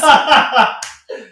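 high burst of laughter; real loud laugh. Real, not acted. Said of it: "laughing at banter".

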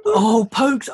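normal talking voice

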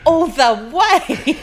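loud, high, stretched-out cheer; excited school pride. Real, not acted. Host cheering for Hastings Girls High School, excited pride. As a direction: draw it out loudly with laughing pride.

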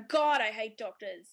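slow, loud, scornful aside; drawn-out mock contempt; mock disdain. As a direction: sneer it out slowly. Mock reply to a doctor.

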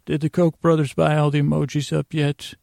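ordinary talk, nothing marked

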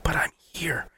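very soft, hushed retold protest; imitating a small, bewildered voice; stunned whisper. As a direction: barely say it, confused. Stunned high self, retold.

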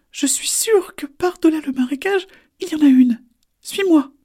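very high, squeaky little turtle; tiny high turtle voice. Acted. Lulu leads the way.

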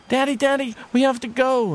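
put-on high, loud child's call; high insistent kid; eager little boy. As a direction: urge dad it's time to go. Boy calls father to leave.

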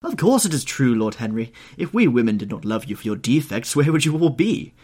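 loud, high, emphatic hostess; spirited retort. A narrator's reading. Hostess answering Lord Henry on marriage, spirited retort. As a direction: fire back with lively conviction.

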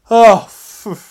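high loud relieved phew; mock phew. Comic relief after fleeing the shop, loud phew. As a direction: blow out a big loud phew.

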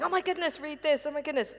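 replaying my own excitement on camera; excited exclaiming. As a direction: gush like you can't believe what you're reading. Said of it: "I was getting very excited".

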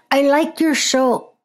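put-on a brief stab at a Dutch accent, quickly dropped; aborted Dutch accent. Reading a listener email in a half-tried Dutch accent. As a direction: start a Dutch accent then give up. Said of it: "not gonna try and do a Dutch accent".

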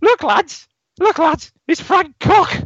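very high squeaky mock shout; high-pitched shout. Footballer shouting across the training ground, very high squeaky yell. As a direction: shriek it like a squeaky-voiced footballer. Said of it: "in his high-pitched accent, shouts".

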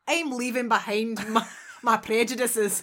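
attempted Scottish accent, slightly laughing; amateur Scottish accent. Shown as a demonstration. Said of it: "Okay, that's Scottish".

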